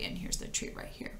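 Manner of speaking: whisper. Real, not acted. Aside whispered to child.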